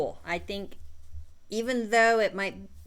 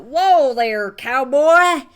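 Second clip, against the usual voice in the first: big, high cowboy holler; yelled game call; 6 semitones higher, 13 dB louder, much slower than usual. Shown as a demonstration. Showing players what to yell instead, hollered. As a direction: belt it out across the table. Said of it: "you yell out".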